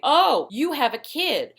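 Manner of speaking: high, drawn-out mock-scandalized jab; catty coworker. Catty coworker exposing a woman's secret child, mock scandalized. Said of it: "Some catty bitch comes over".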